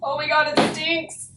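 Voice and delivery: high, grossed-out outburst; disgusted. Real, not acted. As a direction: recoil at the stink. Reacting to smelly spray adhesive.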